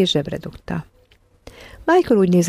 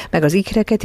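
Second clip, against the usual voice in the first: ordinary delivery, nothing marked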